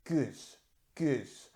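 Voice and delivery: goose, vowel halfway between oo and uh; French goose with silent final E, said softly; French pronunciation. Shown as a demonstration. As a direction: repeat goose slowly apart, one syllable with hard G. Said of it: "combination of oo and uh".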